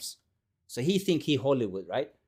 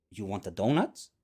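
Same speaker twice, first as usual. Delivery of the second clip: low, rising bait question; teasing offer; 9 semitones lower, 4 dB quieter than usual. Retold or imitated. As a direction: dangle the offer in a low voice, lifting at the end. Teller baits JD with donut joke.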